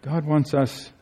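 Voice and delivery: ordinary talk, nothing marked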